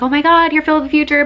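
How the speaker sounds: imitating an excited fan; retold starstruck exclamation. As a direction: act out spotting someone famous. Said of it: "and I was like".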